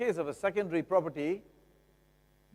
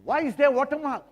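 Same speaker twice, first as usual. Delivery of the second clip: higher and louder, demanding; pointed question; 9 semitones higher, 7 dB louder than usual. Retold or imitated. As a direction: press the seller hard. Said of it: "the next obvious question is".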